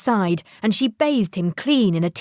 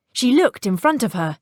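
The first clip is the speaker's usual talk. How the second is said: usual speaking voice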